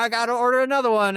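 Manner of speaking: high, loud jokey complaint; mock-exasperated dad. Dad after spilling his kid's drink, loud mock complaint. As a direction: imitate a dad loudly making light of it.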